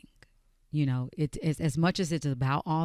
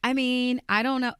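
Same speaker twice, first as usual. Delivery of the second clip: higher imitation of a friend's way of talking; playful higher take on how a friend talks; 8 semitones higher, 4 dB louder than usual. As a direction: mimic your friend, pitched up. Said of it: "My friend talks like, she's like".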